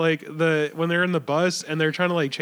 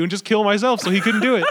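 ordinary talk

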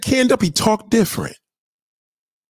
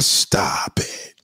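whispered; 5 dB quieter than usual. Real, not acted. Dismissing chat mid rant.

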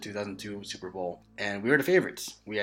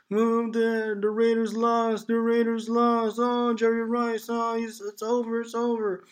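much higher, slow, hammed-up child's wail; wailing kid; 13 semitones higher, 5 dB louder, slower than usual. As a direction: play the heartbroken kid crying to his parents. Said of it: "And I was like".

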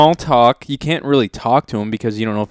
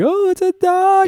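mock dumb passerby; slower than usual. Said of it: "People are like".

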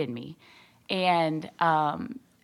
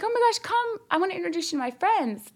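high-pitched, gushing imitation of a friend; eager invitation; 11 semitones higher than usual. Friend inviting her to meet her friends, eager invitation. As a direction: pull her along with excitement.

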